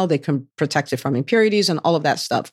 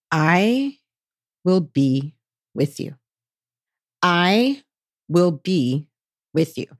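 slow, with long pauses between phrases; God's solemn promise; slower than usual. Acted. The Lord reassures doubting Gideon, slow solemn promise. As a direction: let each phrase stand alone, unhurried. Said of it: "And the Lord said to him".

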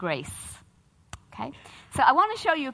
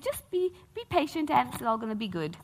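soothing dentist; 3 semitones higher, 5 dB quieter than usual. Retold or imitated. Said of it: "the dentist said".

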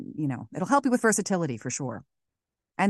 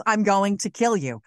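menacing threat; 3 semitones higher, 6 dB louder than usual. Retold or imitated. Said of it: "her level is".

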